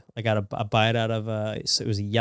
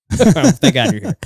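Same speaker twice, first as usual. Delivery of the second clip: louder, higher and slow through laughter; laughing; 8 semitones higher, 8 dB louder, slower than usual. Retold or imitated. Parent joking about needing a translator, laughing. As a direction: laugh your way through the line.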